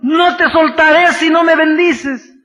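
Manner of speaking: loud, high, desperate shouted pleading; a man clinging on and shouting for a blessing; desperate plea. Acted. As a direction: beg with everything you have, crying out. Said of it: "se agarra de Cristo desesperadamente".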